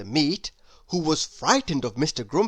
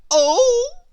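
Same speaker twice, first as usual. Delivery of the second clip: very high, loud squeaky exclamation; a mischievous imp delighted by the plan; gleeful Mister Tickle; 9 dB louder than usual. Acted. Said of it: "he grinned".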